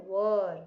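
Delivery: "were" with a central "er" vowel; correct pronunciation. Shown as a demonstration. Teacher giving pupils the pronunciation, correct pronunciation shown. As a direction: say "were" rhyming with "her". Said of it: "इसका pronunciation है".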